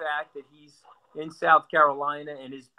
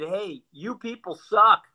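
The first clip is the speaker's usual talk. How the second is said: raised, emphatic mock grievance; higher and louder, landing each word like an acted complaint; mock voter complaint; 5 semitones higher, 4 dB louder than usual. Voters putting a complaint in the suggestion box, acted blunt indignation.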